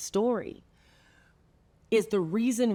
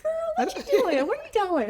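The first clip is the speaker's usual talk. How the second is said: very high, playful squeal of hello; retold excited greeting; 4 dB louder than usual. Running into a friend at the Cheesecake Factory, excited high greeting. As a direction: call to a girlfriend, voice shooting way up.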